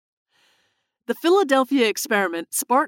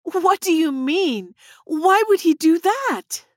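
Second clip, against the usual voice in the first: high, slow, incredulous outcry; drawn-out, high-pitched dismay; crying out; 9 semitones higher, 3 dB louder, slower than usual. A narrator's reading. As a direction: cry out the questions with pitch soaring. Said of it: "Tess cried out".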